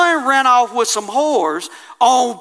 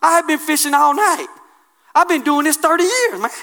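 lightly voiced annoyed fisherman; a hint of a proud fisherman arguing; Peter protesting; 4 semitones higher than usual. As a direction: push back on Jesus a bit indignant. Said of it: "He said".

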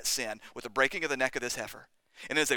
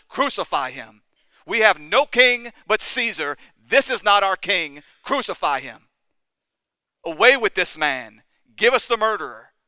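loud, slow, raised crowd cry; crowd shouting; 6 semitones higher, 12 dB louder than usual. Retold or imitated. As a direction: bellow it like an angry mob. Crowd's cry voiced in sermon.

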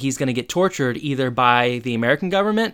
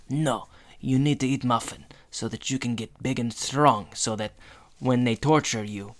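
put-on a light Russian accent, slowing at the end; Zoya Russian accent; 5 dB quieter than usual. Russian spy mom pushing a muffin on her son, in a Russian accent. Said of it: "practiced your Zoya impression".

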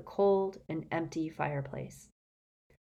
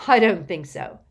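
louder scornful refusal; snorting refusal; 9 dB louder than usual. A narrator's reading. Harry rejects releasing the elf, scornful. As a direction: dismiss the idea firmly. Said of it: "snorted Harry".

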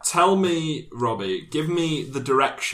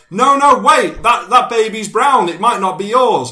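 high, loud frantic yell; doctor shouting after the fleeing father; 11 semitones higher, 11 dB louder than usual. Retold or imitated. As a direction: yell after him down the ward. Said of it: "the doctor shouts after him".

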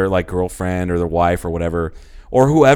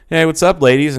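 higher, louder cocky hello; mock smooth talker greeting the ladies; 7 semitones higher, 6 dB louder than usual. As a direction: swagger in with a big cat. Jaguar owner greeting strippers.

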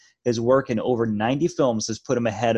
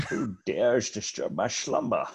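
grinding stone statue; 6 dB quieter than usual. Retold or imitated. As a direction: rumble like a stone giant. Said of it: "based the voice of the Cave of Wonders".

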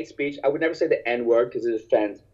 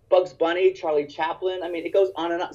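normal talking voice

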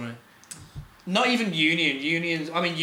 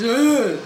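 loud, high mock caveman grunt; 7 semitones higher, 7 dB louder than usual. To his friends, mocking the accent with a caveman grunt. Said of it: "how the cavemen used to speak".

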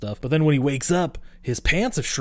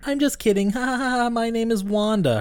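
put-on much higher giggling girl voice; teasing girl voice; 11 semitones higher than usual. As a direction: play the girl laughing off her fib. Voicing girl in retold scene.

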